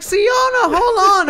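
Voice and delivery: very high, loud plea to stop; stuck in the dumpster, calling to the fleeing girl; desperate call. Retold or imitated. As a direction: shout after her to wait. Calling after her as she runs.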